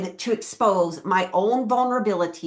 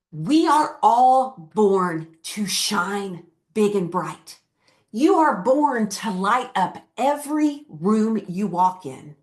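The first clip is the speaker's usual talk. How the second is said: inspirational speech; slower than usual. Acted. Inspirational closing message.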